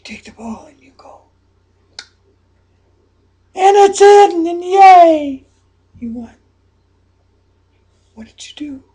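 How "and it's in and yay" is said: sudden loud happy cheer, higher; excited cheer. Acted. Coach picturing the winning shot, loud excited cheer. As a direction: burst out cheering as the ball drops in.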